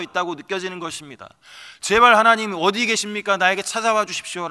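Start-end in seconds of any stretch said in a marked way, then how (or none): 1.8-4.5 much higher and much louder begging; full-throated anguished plea; desperate cry. Acted. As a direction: scream out to a silent God. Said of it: "라고 절규하고 있는".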